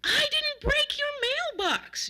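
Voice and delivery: very high, slow indignant yell; loud, high, drawn-out denial; shouting back. A narrator's reading. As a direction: yell each word in protest. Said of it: "James shouted back".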